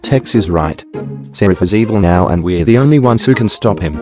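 rallying shout. Acted. Prince rallies loudly.

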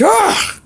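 high mock scream of frustration. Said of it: "I was like".

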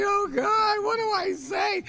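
high, loud and slow, nearly shouting; flustered fan who freezes before her idol; starstruck panic. Retold or imitated. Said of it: "I'm like".

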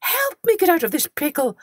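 high, frantic pleading; begging. Acted. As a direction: beg for rescue, voice way up. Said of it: "he begged me".

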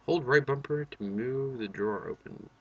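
ordinary talk

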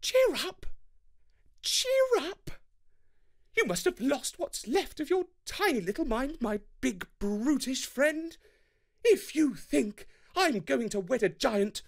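a furious bride-to-be sneering at Thor; scornful Freya. A narrator's reading.

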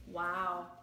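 ordinary delivery

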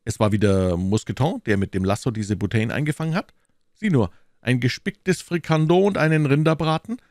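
lively, teasing musketeer; animated, slightly lifted character voice; amazed musketeer guest. Acted. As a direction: marvel at the dishes on the table.

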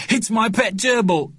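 put-on high, plaintive child's wail; upset boy. A narrator's reading. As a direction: insist as a child near tears. Boy about to cry.

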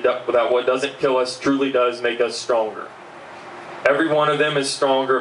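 ordinary talk, nothing marked

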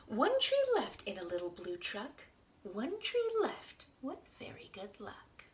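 lively storybook read-aloud; reading to children. Acted. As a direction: read it out to little kids. Reads picture book to children.

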